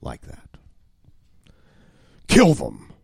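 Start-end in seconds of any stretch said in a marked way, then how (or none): 2.3-2.9 sudden loud command; Satan. Acted. Agitator projecting thoughts into empty minds, abrupt loud urging. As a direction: punch it out as the agitator's order. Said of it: "he may project".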